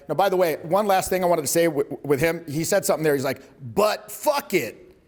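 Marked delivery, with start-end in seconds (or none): 3.7-4.9 BUT drawn out, a beat, then FUCK IT; dramatic pause. Retold or imitated. Coach quoting the student's pause, slow and deliberate. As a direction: imitate slowly, pausing after BUT. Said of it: "vocal talent. He pauses".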